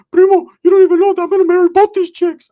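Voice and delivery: way up high and loud, cocky friend bragging; loud high cartoonish bro voice; high-pitched Ricky voice. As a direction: squeak it out like a boastful buddy. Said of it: "He's like".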